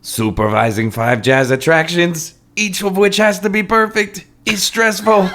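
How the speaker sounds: put-on hammy high Cajun French accent. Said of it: "try to do my incredibly accurate French accent".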